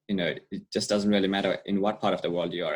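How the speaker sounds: usual speaking voice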